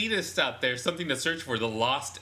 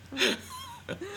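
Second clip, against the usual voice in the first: high squealing laugh; 4 dB quieter than usual. Real, not acted. Laughing at own tangent.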